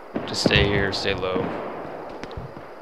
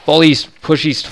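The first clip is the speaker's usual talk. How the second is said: loud urgent call to fall back; shouting orders over battle; 5 semitones higher, 7 dB louder than usual. Real, not acted. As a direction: bark the retreat order. Retreat called under fire.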